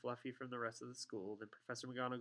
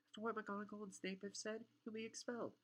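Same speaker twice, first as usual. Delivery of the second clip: put-on high-pitched, worried girl's voice; alarmed protest; 10 semitones higher, 3 dB quieter than usual. A narrator's reading. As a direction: plead with him in a much higher voice. Alarmed Hermione protests to Harry.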